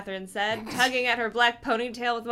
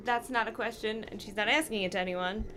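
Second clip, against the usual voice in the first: ordinary delivery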